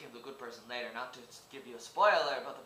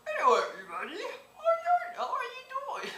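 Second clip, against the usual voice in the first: guinea pig voice; 6 dB louder than usual. Acted. Showing viewers how his guinea pig character sounds, very high squeak. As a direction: squeak it up high like a tiny cartoon rodent. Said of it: "the guinea pig makes a voice like".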